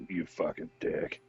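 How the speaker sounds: hushed, whispered acted grumble; faint unconscious mutter. Downed player cursing crewmate while lying unconscious, faint whispered mutter. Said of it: "laying here unconscious, and I'm just like".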